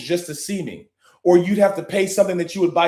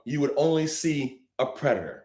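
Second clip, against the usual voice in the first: ordinary delivery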